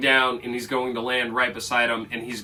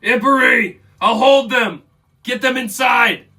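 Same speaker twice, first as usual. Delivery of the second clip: high, loud urgent yell; 10 semitones higher, 9 dB louder than usual. Acted. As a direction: shout orders to an ally under attack. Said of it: "then he yells".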